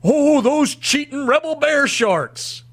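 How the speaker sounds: slow, high mock outrage. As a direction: sneer it out in a mocking drawl. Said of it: "I took the exact same tone".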